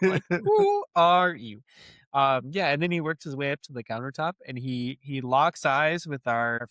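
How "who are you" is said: who are you, said through laughter; laughing quote. Retold or imitated. Friend retelling a scene, laughing as the stranger. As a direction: laugh while asking it.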